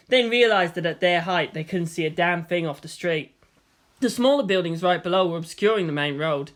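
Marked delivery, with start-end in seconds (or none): none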